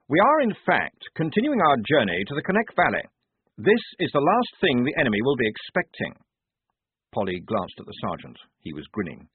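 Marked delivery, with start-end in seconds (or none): 0.1-6.2 a keen, proper lieutenant voice, pitched higher; addressing the men with eager self-importance; the lieutenant briefing his squad; earnest young officer. A narrator's reading. Blouse continues briefing.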